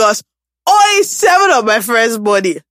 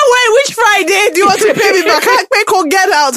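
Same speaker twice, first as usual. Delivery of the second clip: high-pitched indignant tirade; mock outrage; 10 semitones higher, 4 dB louder than usual. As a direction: imitate a fed-up lender demanding answers. Acting out outraged creditor friend.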